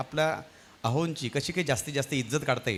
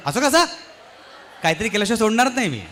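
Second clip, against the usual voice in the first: put-on teasing girlfriend voice; 8 semitones higher than usual. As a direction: squeal it like an eager old college friend. Friend teasing him at home. (उंच, लाडिक, चिडवणारा मैत्रिणीचा आवाज)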